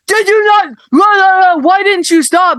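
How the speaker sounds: high, loud mock yell at the driver; imitating angry driver. Angry driver yelling at [name] out his window, high loud shout. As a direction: bark it out like a furious man at his window. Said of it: "Screaming at me".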